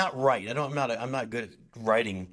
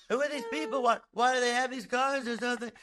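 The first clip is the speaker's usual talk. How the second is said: much higher, whiny mock Seinfeld bit; Seinfeld impression; 12 semitones higher than usual. Comic telling a story to podcast hosts, hammed-up Seinfeld voice. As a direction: pitch it way up like a bad Seinfeld take. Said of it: "my impression of Seinfeld's not that good".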